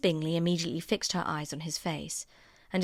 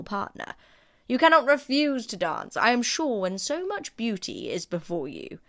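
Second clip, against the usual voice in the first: a hearty, higher courtly announcement; gallant Sir William; 4 semitones higher, 5 dB louder than usual. Acted. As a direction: play the matchmaking host. Sir William's voiced dialogue.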